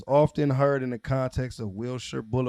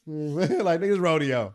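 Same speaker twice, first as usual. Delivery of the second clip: ordinary talk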